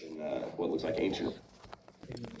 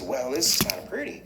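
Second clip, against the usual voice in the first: higher cartoon truck, mildly pleased; Mater; 8 semitones higher than usual. Truck admires the jungle, higher character voice. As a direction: lift the pitch, admiring. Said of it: "he says".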